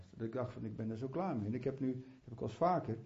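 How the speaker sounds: normal talking voice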